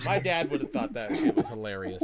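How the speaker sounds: words breaking into laughter; laughing. Real, not acted. Laughing about dad.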